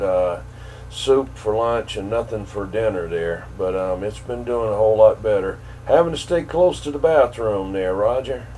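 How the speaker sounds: usual speaking voice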